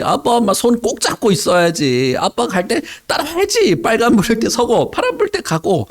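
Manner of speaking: high, shrill-pitched worried scolding; a frantic mother telling off her lost son; scolding mother Mary. Acted. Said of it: "이렇게 말했어요. 엄마 마리아가".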